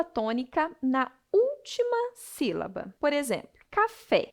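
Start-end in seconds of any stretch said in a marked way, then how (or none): 3.7-4.3 "café" with the stress on FÉ; raised "café", landing firmly on the last syllable; stress on final syllable. Shown as a demonstration. Teacher giving an oxytone example, "café" stressed on FÉ. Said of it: "A sílaba tônica é fé".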